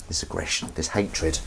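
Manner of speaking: low, soft aside; dropped right down in pitch and volume; hushed reflection. Real, not acted. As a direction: mull it over quietly to yourself. Musing on his own aggressive tone.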